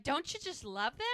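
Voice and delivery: high-pitched hopeful gush; eager gushing. Retold or imitated. Ladies show Hope their rice bags, gushing. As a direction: play up the ladies' delight.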